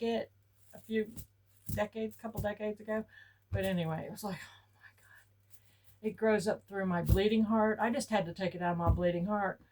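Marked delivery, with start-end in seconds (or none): 4.4-6.1 hushed breathless whisper, very slow; awed whisper. Retold or imitated. Seeing the weed-filled yard, a slow stunned whisper. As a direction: whisper it in horrified awe.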